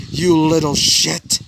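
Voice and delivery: slow, loud snarl in a strange voice; furious curse. Acted. Villain's other face snarls at Izuku in fury. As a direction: spit the insult at the hero.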